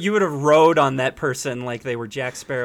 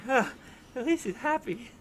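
lightly voiced, higher, softer sigh; resigned; 9 semitones higher, 8 dB quieter than usual. Imagined Carlos waking in the back seat, mildly resigned. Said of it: "You're just like".

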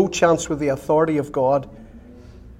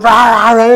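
imitated dog bark; 6 semitones higher, 9 dB louder than usual. Dog barking at the fence.

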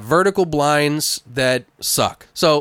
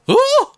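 short, high, loud mock shriek; startled whoop, imitating a scream; imitated scream; 7 dB louder than usual. Retelling partner startled on the treadmill, mock scream. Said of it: "what the hell you're screaming about".

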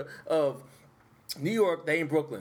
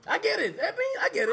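high, squeaky laughing voice; cracking up mid-sentence; laughing hard; 12 semitones higher than usual. Real, not acted.

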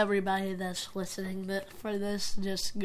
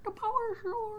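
the power source in a very high squeak; squeaky silly voice; 13 semitones higher, 5 dB quieter than usual. Shown as a demonstration. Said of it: "silly repeat of the phrase".

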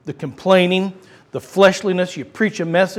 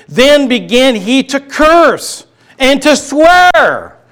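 loud, slow, every word weighed; slow thundering verse; dramatic scripture read; 7 semitones higher, 8 dB louder, much slower than usual. Acted. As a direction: proclaim it slowly. Preacher reading Peter's oath.

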